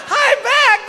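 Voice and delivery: very high laughing repeat; drugged giggly squeal. Retold or imitated. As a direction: squeal it back, doped and giggling. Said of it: "And I went".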